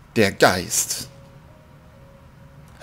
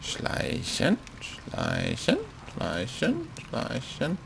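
whispered sneaking chant, fading away; a soft whispered sneak chant; sneaky whisper; 3 semitones higher, 11 dB quieter, much slower than usual. Pretend. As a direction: tiptoe it in a hush. Sneaking in the game.

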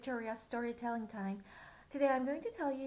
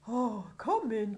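jolly king; 3 semitones lower, 8 dB louder than usual. Acted. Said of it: "cried the king with a jig".

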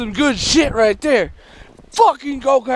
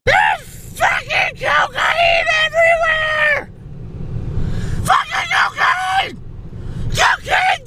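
very high, drawn-out screech; hammed-up high-pitched shrieking; frenzied screaming; slower than usual. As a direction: scream the discovery out to everyone, stretching the words. Acted frenzy over finding cocaine everywhere.

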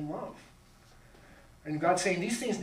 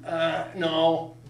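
curt refusal; 6 dB louder than usual. Retold or imitated. Curt refusal in acted exchange.

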